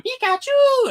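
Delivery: very high, loud creature squeal; Pikachu cry. Retold or imitated. Pikachu bursting through the window, high loud squeal. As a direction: squeal it like the electric mouse.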